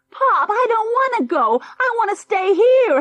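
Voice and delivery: put-on high, loud wailing boy; a sulky boy howls to stay; howling child. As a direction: wail it out like a spoiled child. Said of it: "howled Junior".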